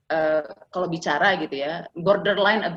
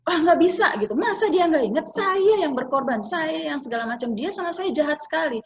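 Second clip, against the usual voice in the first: mildly imitated indignation; indignant mother; 8 semitones higher than usual. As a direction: vent like a hurt, fed-up wife. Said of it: "gitu ya".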